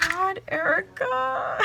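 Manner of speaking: high, slow, tearful cry of amazement; overwhelmed, close to happy tears; tearful awe. Real, not acted. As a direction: draw it out high, nearly crying. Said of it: "I'm gonna cry".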